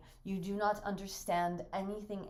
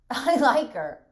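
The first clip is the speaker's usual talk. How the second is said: bitter laugh; 3 semitones higher, 14 dB louder, slower than usual. Acted.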